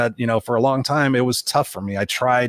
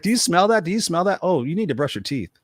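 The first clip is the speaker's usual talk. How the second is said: higher, teasing put-down voice; mock comeback; 6 semitones higher than usual. Imagining his comeback to her bullies, higher teasing taunt. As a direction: needle them with a sassy retort. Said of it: "I'm like".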